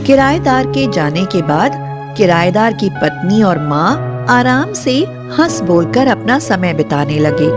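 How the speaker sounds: plain reading voice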